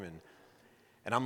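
ordinary delivery, nothing marked

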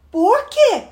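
put-on high-pitched childish question; pestering little girl. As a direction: needle big sister like a nagging kid. Little girl pesters older sister.

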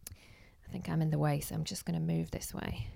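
unvoiced quick aside; whispered aside. Real, not acted. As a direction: murmur it under your breath as a whisper. Aside while moving out of way.

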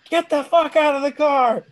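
high, loud mock yell of an angry cop. Mimicking the cop yelling at the driver, angry high mock yell. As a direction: bark the order like a furious cop. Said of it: "the cop gets pissed off".

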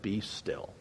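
hushed, soft command to the storm; very soft, calm "Be still"; gracious calm command. Acted. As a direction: say it gently, barely above a breath. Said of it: "graciously spoke to the wind".

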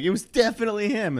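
high, fast mock voice of a teacher pinning it on the kid; mock accusing teacher. Friends joking about the teachers, in a high, fast mock voice. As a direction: squeak it out as a teacher quick to blame.

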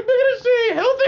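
high, fast, laughing disbelief; cracking up while shooting it down; laughing retort. Retold or imitated. To a kid who calls it healthy, laughing disbelief.

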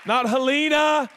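Helena as "huh-LEE-nuh", loud and high; mispronounced Helena. Shown as a demonstration. Preacher mocking guest pastors' mistake, loud "huh-LEE-nuh" for Helena. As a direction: shout Helena with the stress on a long "LEE". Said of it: "they pronounce it Helena".

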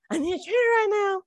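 high-pitched imitating yell; mock yell. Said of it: "like, yelling it".

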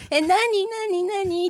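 a light imitation of a cutesy high voice; puppy-girl voice. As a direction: mimic a clingy girl whining what, what. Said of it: "本当にその犬系女子みたいな声でさ".

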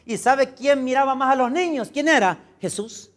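high, loud pulpit proclamation; full-force preaching. Real, not acted. Pastor quoting Jesus on children to congregation, full-force preaching. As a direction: thunder the scripture out to the congregation.

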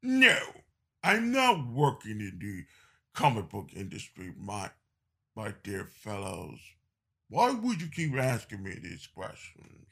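low gravelly Englishman; Alan Moore voice. Retold or imitated. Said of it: "And Alan Moore says in his gravelly voice".

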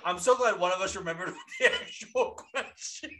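amused, breaking up mid-sentence; laughing. Real, not acted. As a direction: barely get it out for laughing. Cracking up at himself.

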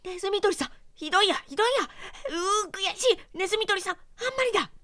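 very high, loud frantic wailing; frantic mouse. A narrator's reading. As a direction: wail in a trapped panic. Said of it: "気違いのようになって". (気が狂ったように泣きわめくネズミ)

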